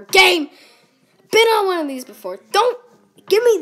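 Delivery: loud, fast exasperated outburst; annoyed gamer shout; frustrated yell. Real, not acted. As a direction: yell at the broken game.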